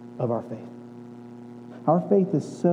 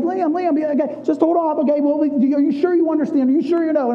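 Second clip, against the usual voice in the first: cautious dad; 8 semitones higher, 3 dB louder than usual. Retold or imitated. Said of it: "And I'm like".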